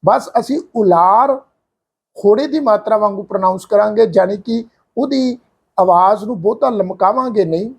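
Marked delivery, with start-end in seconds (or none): none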